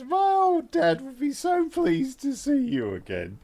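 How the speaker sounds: high-pitched, delighted greeting; gushing Alicia. Acted. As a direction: coo it high to a long-lost friend. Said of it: "She goes".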